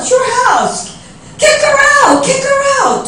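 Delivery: high, emphatic urging to kick her out. Retold or imitated. Telling sister-in-law to throw the guest out, high and insistent.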